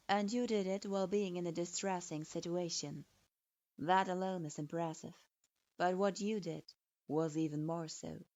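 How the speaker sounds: deliberate, authoritative male voice; stern lord voice. Acted. Hyuga-sama speaks.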